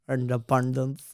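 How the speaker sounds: playfully imitated Indian accent. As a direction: repeat it to yourself with an Indian accent. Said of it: "driving alone in my car".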